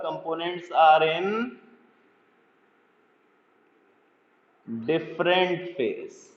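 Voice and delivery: very slow note-taking pace; slow dictation. Shown as a demonstration. Teacher dictating the definition for students to copy, very slowly. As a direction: stretch each word out.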